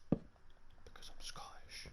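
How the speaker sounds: slow secret whisper; whispered aside. Acted. Vlogger confides his Scottish roots to viewers in a slow whisper. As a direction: let the punchline slip out in a slow whisper. Punchline aside after accent talk.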